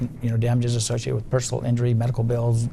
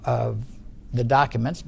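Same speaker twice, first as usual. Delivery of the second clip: ordinary delivery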